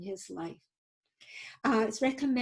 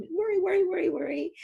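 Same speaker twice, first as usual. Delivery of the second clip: puppet voice; 9 semitones higher, 3 dB louder, faster than usual. Pretend. Voicing the worry wart puppet.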